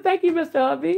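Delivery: gently teasing impression of how she thanked him; imitating Ann. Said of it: "She said".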